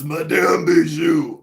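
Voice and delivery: slow, hammed-up French accent; unsexy French accent. Proving to viewers the French accent isn't sexy, hammed-up French accent. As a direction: draw the name out in a comically bad French accent. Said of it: "French accent is sexy. It's not".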